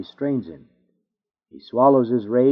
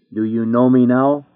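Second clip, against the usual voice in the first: louder, hard challenge on me; terrible voice; 5 dB louder than usual. Acted. The judge confronts the sinner before his tribunal, loud. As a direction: throw the question down like a verdict. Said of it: "he will call them out with a terrible voice".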